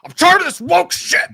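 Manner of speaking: high, loud sarcastic put-on voice; mock bigot. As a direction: ham up an outraged reactionary.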